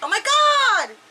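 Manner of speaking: high-pitched, shocked exclamation; real shock. Real, not acted. Reader reacts to the story, shocked.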